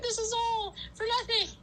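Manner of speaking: high, despairing mock wail; mock crying. Exhausted runner giving up on the route, mock crying. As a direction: whine it out, exaggerating the despair. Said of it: "I was, like, crying".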